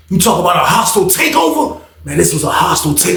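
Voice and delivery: ordinary talk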